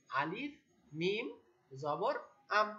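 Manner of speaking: letter by letter, with gaps and rising letter names; spelling out. Shown as a demonstration. Teacher shows learners how to spell, spelling out. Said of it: "আমরা এভাবে বানান করব".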